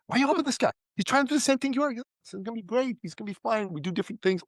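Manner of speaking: playing mom pleading with dad; mom voiced. Son imitating his mom questioning dad's generosity, lightly voiced. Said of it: "She'd go".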